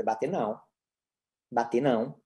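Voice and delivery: firm but unraised correction; short repetitive command. Shown as a demonstration. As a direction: say it low and even, repeated identically. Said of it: "frase repetitiva e curta".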